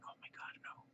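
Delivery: breathless whispered disbelief; whispering in shock after the surgeon cancels; stunned whisper. Retold or imitated. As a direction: whisper it like the air went out of you.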